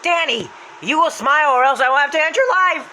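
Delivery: high, loud threat; Happy's voice. Retold or imitated. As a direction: shout the warning down a tunnel. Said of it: "Happy runs in the tunnel and says".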